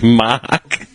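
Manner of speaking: broad Australian vowel on Mark; Australian accent. Shown as a demonstration. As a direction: Mark the Aussie way, no R. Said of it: "how stupid it actually sounds".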